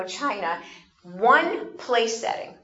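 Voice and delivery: ordinary delivery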